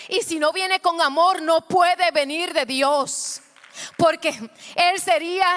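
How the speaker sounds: loud, high, rising preacher's cry; preaching at full force. Acted. Preacher urging the church to give it to the Lord, shouting.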